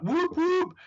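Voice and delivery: high-pitched whooping; party whoops. Retold or imitated. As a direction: whoop it up in a high voice, imitating her. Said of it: "she was like".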